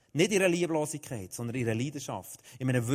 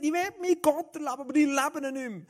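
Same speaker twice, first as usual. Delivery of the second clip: much higher, louder, fast cry of longing; desperate shout; 12 semitones higher, 5 dB louder than usual. Retold or imitated. Teenager screams out that he no longer feels God, high and loud. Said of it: "der schreit und sagt".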